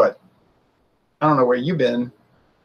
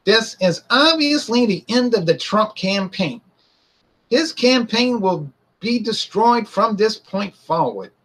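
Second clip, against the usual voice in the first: higher, slow pompous anchor voice; mocking news anchor; 7 semitones higher, slower than usual. Pundit mimicking CBS anchors, higher and slow. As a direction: lay on the gloomy TV verdict, slowly. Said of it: "they were coming on the news".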